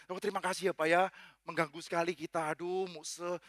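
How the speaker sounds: meek, hushed politeness to the pastor; polite apology. Acted. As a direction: apologise quietly for being a bother. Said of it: "itu sopan santun standar hamba Tuhan".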